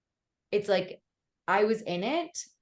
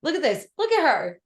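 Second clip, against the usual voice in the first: mock sassy self; 8 semitones higher, 7 dB louder than usual. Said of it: "I looked really sassy and I was like".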